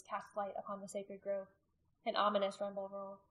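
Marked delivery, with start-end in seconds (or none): none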